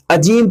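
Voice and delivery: عجیم with a j, "ajeem"; wrong j sound. Shown as a demonstration. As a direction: mispronounce it as عجیم, j for the heavy ظ. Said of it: "جیم پڑھتے ہیں". (عجیم کو جیم سے پڑھنا)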